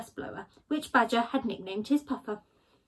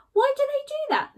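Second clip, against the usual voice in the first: high, baffled Skunk question; puzzled Skunk; 3 dB louder than usual. Acted. Skunk puzzling over cereal boxes, high. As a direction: wonder aloud, much higher.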